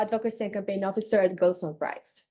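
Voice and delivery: ordinary talk, nothing marked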